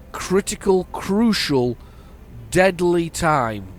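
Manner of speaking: mock-dramatic urgency. Driver announcing a critical deadline, mock-dramatic urgency. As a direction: hammer out the urgency, drawn out at the end.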